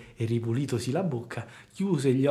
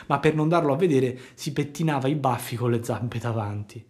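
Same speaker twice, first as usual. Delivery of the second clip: ordinary delivery, nothing marked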